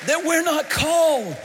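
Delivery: loud, high preacher's cry; full-force preaching. Acted. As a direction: lift the congregation with it.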